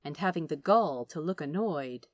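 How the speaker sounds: ordinary delivery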